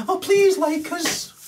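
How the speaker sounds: high, slow, simpering plea; a hammed-up needy whimper; mock pleading. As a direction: whine it like a begging victim. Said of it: "But you're meant to go".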